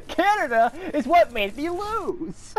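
high-pitched, on the edge of laughter; laughing complaint. Real, not acted. Gamer blaming Canada for losing, high laughing complaint. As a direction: protest helplessly between laughs.

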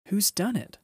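high-pitched, lightly acted outcry; upset cry. A narrator's reading. Susan at the broken table, upset cry. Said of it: "cried Susan".

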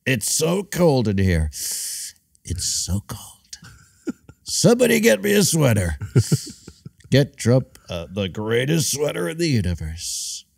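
kvetching Trump voice, whispered then laughing. Pretend. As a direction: complain about the cold like Trump.

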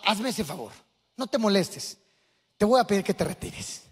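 put-on an older man gently asking him to leave; calm and gentle. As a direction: soothe an angry young man quietly. Elder calmly asks him to leave.

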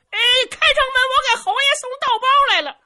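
high, loud, fast country-bumpkin voice; simple fellow calling up. Acted. Zhu Can calls up to the soldiers, high eager holler.

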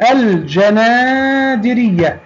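الجنادرية slowly, clear l and long a; drawn-out teacher demo of الجنادرية, l sounded; moon lam. Shown as a demonstration. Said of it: "لام قمرية". (نطق «الجنادرية» ببطء مع إظهار اللام ومد الألف)

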